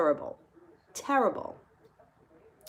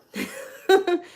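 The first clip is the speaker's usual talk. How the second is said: real high-pitched laugh; burst of laughter; 11 semitones higher, 5 dB louder than usual. Real, not acted. Storyteller laughs at own remark, amused. Laughs after agreeing with listener.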